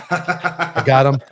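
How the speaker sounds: slow, laughing, pleased with himself; proud laughing boast. Retold or imitated. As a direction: drag it out like a proud puppy dog.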